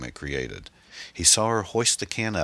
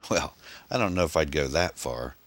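ordinary delivery

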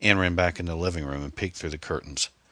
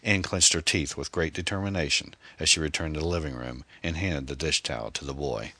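ordinary delivery, nothing marked

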